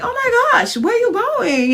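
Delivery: high, loud gushing excitement; excited. Retold or imitated. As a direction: greet a friend with loud glee. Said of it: "I'm like".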